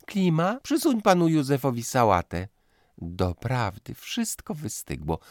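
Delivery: high, bossy lady's voice; fussy hostess. Acted. Mother ordering the dinner table about, high and fussy. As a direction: fuss over the guests and the maid.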